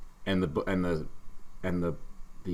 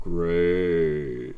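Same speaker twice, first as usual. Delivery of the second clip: sarcastic buzzer; 5 dB louder than usual. Pretend.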